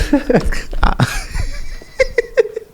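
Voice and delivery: high helpless laugh; laughing at how he was asked; real burst of laughter. Real, not acted. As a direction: crack up at the question. Laughing at the question.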